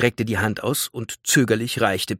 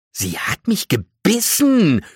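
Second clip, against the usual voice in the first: high, loud, drawn-out complaint; outraged shock; 11 semitones higher, 5 dB louder, slower than usual. Acted. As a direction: wail it in shocked indignation. Outraged after the plant bites her. (empört, hoch und langgezogen)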